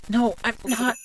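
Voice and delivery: very high, distressed protest; panicked high-pitched objection; frantic denial. Acted. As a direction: cry out in desperate self-defence. Denies being a cheater.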